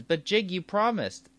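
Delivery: high-pitched mock plea; pleading old man. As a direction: protest like a betrayed old man. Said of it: "the old man says".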